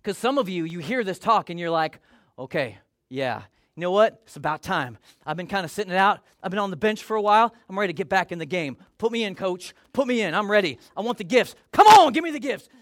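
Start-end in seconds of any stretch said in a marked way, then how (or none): 2.4-12.7 mock eager churchgoer, louder and building; eager fired up. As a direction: pump yourself up, ready to go in. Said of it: "I like that fire".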